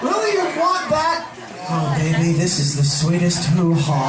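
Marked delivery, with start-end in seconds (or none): 1.7-4.0 low seductive voice. Retold or imitated. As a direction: croon it low like a lover. Mock lover voice, very low.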